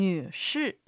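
女士 in Mandarin, nǚshì with 女 dipping and rising in the third tone and 士 falling in the fourth; teacher models 女士 slowly and clearly; Chinese drill word. Shown as a demonstration. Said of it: "では、練習しましょう". (女士を中国語で、女は三声で下がって上がり、士は四声で下げる)

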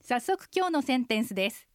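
usual speaking voice

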